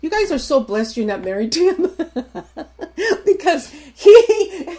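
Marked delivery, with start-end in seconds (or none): 2.0-4.8 laughing through the words; real laughter. Real, not acted. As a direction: break into laughter mid-sentence. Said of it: "he's laughing over there".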